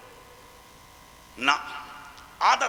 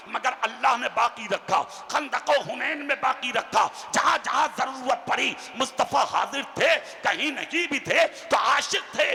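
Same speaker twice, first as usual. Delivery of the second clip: normal talking voice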